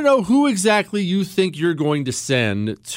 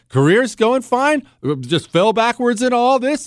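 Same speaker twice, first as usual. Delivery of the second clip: normal talking voice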